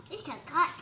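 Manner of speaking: usual speaking voice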